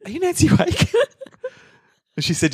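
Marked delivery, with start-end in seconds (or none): 0.0-0.9 mock Bilbo voice. Comic retelling, Bilbo voiced.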